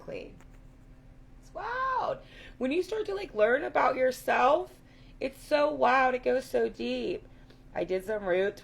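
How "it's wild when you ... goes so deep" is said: excited. Real, not acted. Amazed at learning about herself.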